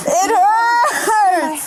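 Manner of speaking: sobbing shout of real pain; crying out in pain. Real, not acted. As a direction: wail it through tears. Handcuffed woman in pain.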